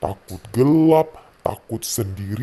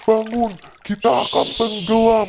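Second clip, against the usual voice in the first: desperate plea; 11 semitones higher than usual. Acted. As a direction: wake him up, terrified you will sink. Disciples wake Jesus in storm.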